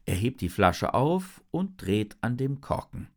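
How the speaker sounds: plain reading voice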